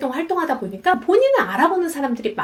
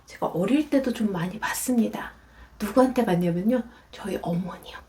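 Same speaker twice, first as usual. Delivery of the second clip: ordinary talk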